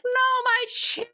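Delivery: put-on high, drawn-out childish cry of "No, my chair!"; screaming out. Little girl cries out over her chair, high and drawn out. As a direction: cry it out like a small girl watching her chair get crushed. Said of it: "screams out".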